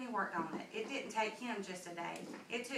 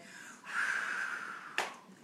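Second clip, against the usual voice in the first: long unvoiced blow of air; big blowing breath. Wolf blowing down the straw house, long whoosh. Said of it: "took a big, deep breath and said".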